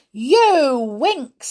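loud, high accusation; shout. A narrator's reading. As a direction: shout at the guilty pupil. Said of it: "he shouted".

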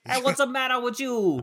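high, hammed-up New York complaint; cheesy New York accent. Riffing with co-host, cheesy New York accent. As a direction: scold like a cartoon New Yorker. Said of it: "really cheesy New York accent".

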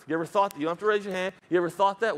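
normal talking voice

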